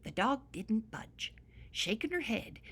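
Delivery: usual speaking voice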